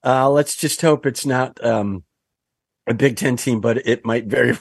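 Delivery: ordinary delivery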